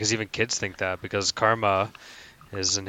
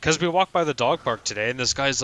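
ordinary talk

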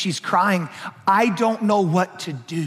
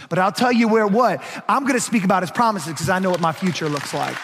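preaching; 5 semitones higher than usual. Acted. Pastor pressing his congregation, full preaching voice. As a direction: preach it hard to the room.

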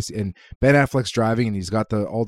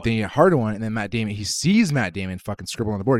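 ordinary delivery

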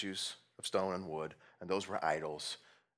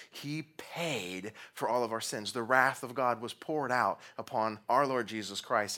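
ordinary delivery

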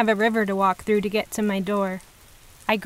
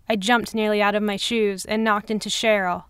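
ordinary delivery

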